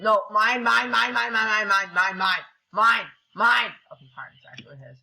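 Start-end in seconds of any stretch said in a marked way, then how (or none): 0.0-3.7 loud, high rapid-fire repeats; frantic claiming. Real, not acted. Gamer claiming loot from others, frantic. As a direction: blurt it out urgently to claim it.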